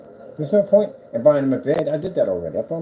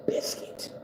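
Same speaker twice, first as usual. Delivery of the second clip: whisper; 10 dB quieter than usual. Real, not acted. Whispered name.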